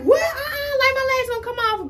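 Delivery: high-pitched mock panic about a falling lash; mock panicked lash wearer. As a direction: play the scared girl whose lash might fall. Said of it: "don't be like".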